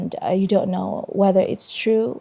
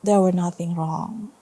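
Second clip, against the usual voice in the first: steady storytelling read to a class; story narration; 5 dB louder than usual. A narrator's reading. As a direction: read it like a teacher sharing a chapter book.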